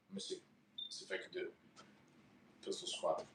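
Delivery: breathless whisper while squatting; barely voiced under the effort of the squat; strained whisper. Real, not acted. Mid-exercise, attempting a pistol squat.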